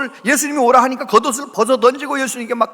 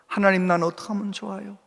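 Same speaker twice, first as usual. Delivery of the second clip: quiet tearful prayer; 7 dB quieter than usual. Retold or imitated. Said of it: "그러면서 막 울어".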